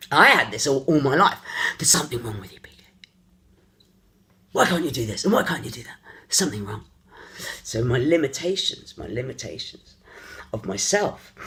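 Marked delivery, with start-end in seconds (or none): 1.8-6.9 whispering the judgment quietly; hushed whisper. Retold or imitated. Voicing people who judged him all his life, hushed whisper. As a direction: breathe the accusation, voiceless and low.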